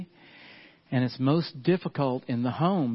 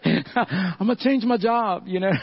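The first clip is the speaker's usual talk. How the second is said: laughing joke; 5 semitones higher, 3 dB louder than usual. Real, not acted. Pastor joking to congregation about quitting, laughing. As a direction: pitch it up, drag it out through laughter.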